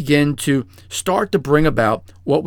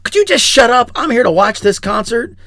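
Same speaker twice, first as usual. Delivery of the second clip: higher, louder irritated outburst; angry snap; 10 semitones higher, 6 dB louder than usual. Retold or imitated. Concertgoer snapping at a noisy neighbour, loud and angry. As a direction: tell the chatterer off sharply. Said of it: "saying something like".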